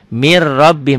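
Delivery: "mir rabbihim" with a double r and no hum; doubled r. Shown as a demonstration. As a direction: lean on the joined r of "mir rabbihim". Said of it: "double aja". ("mir rabbihim", r didobel saja)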